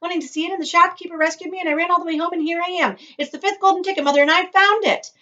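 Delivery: high, loud breathless rush of news; overjoyed. Acted. Charlie tells his family about the ticket, high, loud and excited. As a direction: tumble it all out in one breath.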